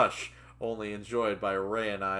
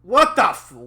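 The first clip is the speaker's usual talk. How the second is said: loud, high burst, cut off; startled outburst; 13 semitones higher, 15 dB louder than usual. Acted. Reader trying out the character's startled curse, loud outburst. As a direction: blurt it out loudly, then break off. Voicing an acted startled line.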